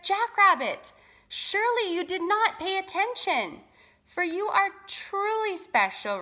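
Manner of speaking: high, emphatic exasperation; storybook spirit put out, then insistent; frowning. A narrator's reading. Great Spirit corrects Jackrabbit's words to Roadrunner, frowning. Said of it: "frowned and said".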